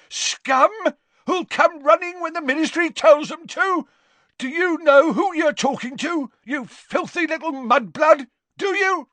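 high, loud tirade; screaming. Acted. Father advancing on the official, screaming. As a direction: rage at him at full voice. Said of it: "screamed Gaunt".

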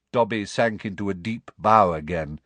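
ordinary delivery

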